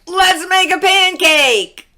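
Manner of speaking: high, loud excited shout; eager shout. A narrator's reading. Jack begging his mother for a pancake, shouting. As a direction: belt it out like an impatient boy. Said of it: "shouted Jack".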